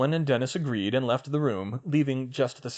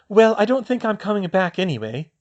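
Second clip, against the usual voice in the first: put-on a higher girl's voice, set and firm; 9 semitones higher, 7 dB louder than usual. Ginny telling her brother she is leaving, firmly. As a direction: state it with no room for doubt. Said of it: "Ginny said firmly".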